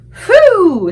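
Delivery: loud high relieved phew. A narrator's reading. BFG sighing with relief after escaping, loud phew. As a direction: blow out the relief. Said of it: "said the B F G".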